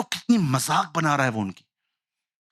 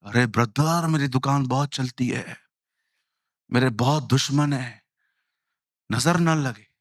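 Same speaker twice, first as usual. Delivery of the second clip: lower, earnest shopkeeper pleading; superstitious shopkeeper; 6 semitones lower than usual. Retold or imitated. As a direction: voice the worried shop owner, a bit lower. Shopkeeper voiced kissing shutter. (नीची आवाज़ में वहमी दुकानदार)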